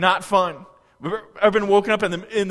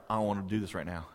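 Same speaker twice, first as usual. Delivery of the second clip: weary groan; 12 semitones lower, 12 dB quieter, faster than usual. Retold or imitated. Weary person in life's storm.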